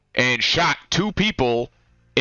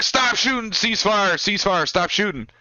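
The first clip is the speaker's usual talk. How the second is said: retold radio scream; 7 semitones higher than usual. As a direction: imitate a urgent ceasefire call over the radio. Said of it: "starts screaming on the radio".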